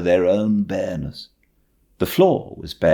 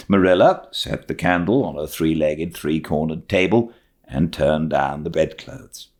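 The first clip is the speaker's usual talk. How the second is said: plain reading voice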